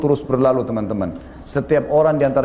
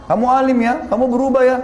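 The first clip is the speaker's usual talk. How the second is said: teasing friend; 8 semitones higher than usual. Retold or imitated. Mocking friends tease new convert.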